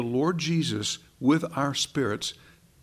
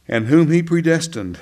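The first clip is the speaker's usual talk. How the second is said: whom he predestined said louder and firmer; louder restart; 3 semitones higher, 8 dB louder than usual. Real, not acted. Preacher restarting the Romans eight verse, louder. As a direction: restart the verse with fresh force. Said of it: "Romans eight".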